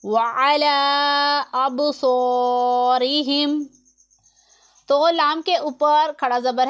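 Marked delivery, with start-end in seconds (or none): 0.0-3.7 very slow, stretched chanted Arabic; tajweed recitation. Shown as a demonstration. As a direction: recite slowly, lengthening the vowels. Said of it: "आगे पढ़ेंगे".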